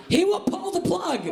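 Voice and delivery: high-pitched East Coast aunt; Aunt Sherry. Acted. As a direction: insist as a high-voiced, fretful aunt. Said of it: "I do that impression".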